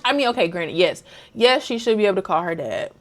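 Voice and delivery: ordinary delivery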